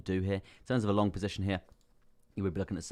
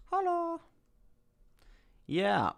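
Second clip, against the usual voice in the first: silly high hello; much slower than usual. Pretend. Sudden silly high greeting.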